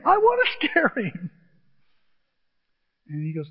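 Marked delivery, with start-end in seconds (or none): 0.0-1.3 high-pitched agitated retort; hammed-up frantic comeback; mock panic. Player answers guide telling him to calm down, panicked.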